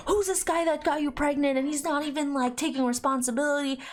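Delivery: lightly voiced scandalized relatives demanding answers; indignant family. Family confronts him about the absent father, indignant.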